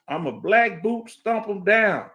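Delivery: drawn-out, deliberate tough-guy line; slow tough boast. Acted. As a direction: lean on each word like a threat. Tough boast about stomping snakes.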